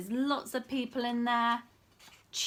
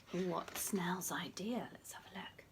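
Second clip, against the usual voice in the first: whisper; 7 semitones lower, 10 dB quieter than usual. Acted.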